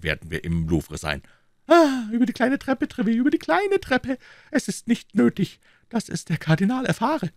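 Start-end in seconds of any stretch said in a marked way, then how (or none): 1.7-7.3 very high-pitched, lively king; conspiring king. Acted. King keeping the visit from the Cardinal, high-voiced instruction. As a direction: instruct your captain in a high head voice.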